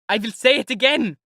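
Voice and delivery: high, loud furious shout; shouting in fury. Acted. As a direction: explode at him. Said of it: "Raskolnikov shouted in fury".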